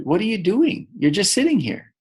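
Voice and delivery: lightly voiced, high-pitched puzzled coyote; incredulous coyote. A narrator's reading. Coyote questions rabbit in story.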